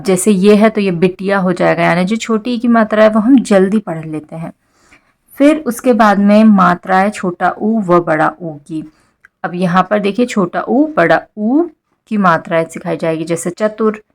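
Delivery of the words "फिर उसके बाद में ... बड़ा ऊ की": ordinary talk, nothing marked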